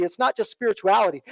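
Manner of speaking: usual speaking voice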